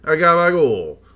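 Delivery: hammed-up Italian accent, high and loud; mock Italian accent, loud and high. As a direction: play a loud cartoon Italian announcer. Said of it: "That was mildly ethnically offensive".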